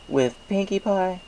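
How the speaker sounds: high, friendly shopkeeper voice; chatty baker pleased with sales; cheerful baker voice. Acted. Baker asks slowly.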